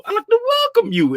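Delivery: high and quick, playful greeting; high fast welcome. Acted. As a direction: squeak it out fast. Welcoming viewers in raised voice.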